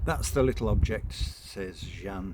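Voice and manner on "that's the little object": raised, brisk dismissive mother; offhand mother. Acted. Mother handing over daughter, brisk and dismissive. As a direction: toss the child off like a parcel.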